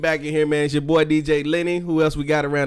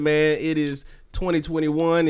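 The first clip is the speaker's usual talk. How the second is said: ordinary talk, nothing marked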